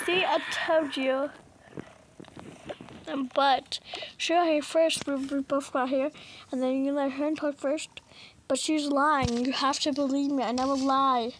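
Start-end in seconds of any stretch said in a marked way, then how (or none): none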